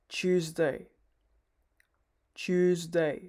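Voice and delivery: Tuesday said as Tues plus day; Tuesday modelled clearly, tooz-day; Tuesday demo. Shown as a demonstration. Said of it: "the same thing here, Tues plus day".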